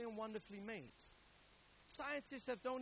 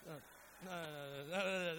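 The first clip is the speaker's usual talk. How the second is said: mock panicked refusal. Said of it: "As soon as they put the nail".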